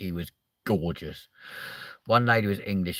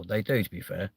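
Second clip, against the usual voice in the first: ordinary talk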